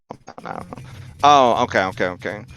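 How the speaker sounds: ordinary delivery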